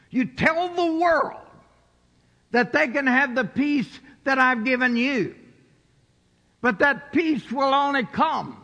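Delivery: high, loud preacher's cry; preaching at full force. Acted. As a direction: lift the room, preaching.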